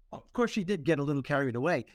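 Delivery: normal talking voice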